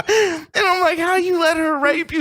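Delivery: high squealing laugh into a question; laughing too hard to ask. Real, not acted. Asking his friend about it, laughing high.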